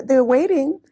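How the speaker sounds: ordinary talk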